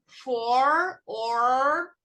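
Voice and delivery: very slow, word-by-word teacher model; drawn-out classroom drill; repeat-after-me slow. Shown as a demonstration. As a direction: stretch each word out for learners to repeat. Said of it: "Repeat after me".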